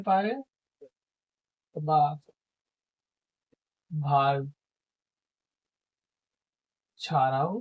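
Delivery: slow, word by word, long pauses; teacher dictating a definition; dictation. Shown as a demonstration. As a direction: dictate so students can write it down.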